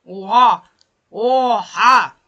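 loud startled outburst; loud stunned cry; shocked exclamation. Real, not acted. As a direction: blurt it out in shock. Shocked reaction in game.

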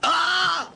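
sharp high yelp of fright; sudden frightened shriek; startled cry. Acted. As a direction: jump out of your skin with a cry. Startled by someone at window.

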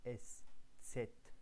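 اس، ست said slowly and whispered; sound demo. Shown as a demonstration. The teacher voices the added letter's sound. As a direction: breathe out اس، ست slowly. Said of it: "يضاف إليه هذا الحرف".

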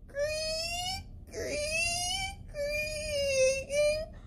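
high, slow creaking wail; spooky high voice. Acted. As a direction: stretch it out high and eerie.

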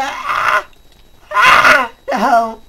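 high shrieks, then a crying no; panicked screaming; panicked screams. Real, not acted. Under attack, panicking.

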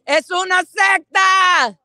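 loud, high, slowed Spanish shout; slogan shout in Spanish. Real, not acted. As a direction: call it out to the Spanish-speaking crowd.

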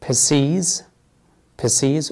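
بيسيز said "pih-SEEZ", short first vowel; alternative pronunciation. Shown as a demonstration. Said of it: "وبإمكانك تسمع بعض الأجانب يقول". (نطق بيسيز بمقطع أول قصير "بِسيز")

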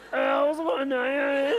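put-on slow drawn-out Cork accent. Mocking the Cork accent to a Dublin crowd, slow Cork lilt. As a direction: stretch it out in a lilting Cork accent. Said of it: "it has that kind of lovely".

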